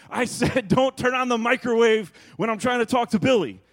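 loud, high frustrated shout through a laugh; exasperated yell. Retold or imitated. Jack yelling at family about the microwave, a loud high exasperated shout. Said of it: "And Jack would be like".

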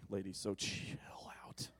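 whispered, teasing aside; playful aside. Real, not acted. Preacher teasing the women, in a whisper. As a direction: lean in and whisper it to the ladies. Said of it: "aside to ladies".

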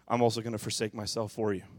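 ordinary delivery

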